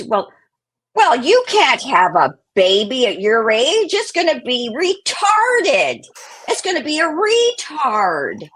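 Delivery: loud, high, drawn-out granny impression; hammed-up old woman, loud and high; outraged old grandmother. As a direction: wail it slowly as an appalled grandmother. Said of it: "So she's older, much, much older".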